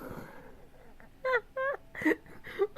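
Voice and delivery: high, helpless squealing laugh; shrieking laugh. Real, not acted. Streamer losing it over the thumbnail, shrieking laughter. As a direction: crack up wildly.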